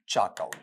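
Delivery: चाकौल low and soft, as "chaa-kaul"; correct pronunciation. Shown as a demonstration. Teacher answering own question, चाकौल without "r". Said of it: "ये क्या बन गया बताओ".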